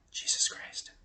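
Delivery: hushed, breathy whisper of a holy name. Retold or imitated. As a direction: whisper it like a secret. Lady whispers the doctor's name.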